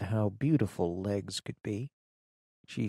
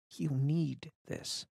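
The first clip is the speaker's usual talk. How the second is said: soft, slow, hushed words to her daughter; mother's whisper; 4 semitones higher, 6 dB quieter, slower than usual. A narrator's reading. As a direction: hush it gently. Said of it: "she whispers".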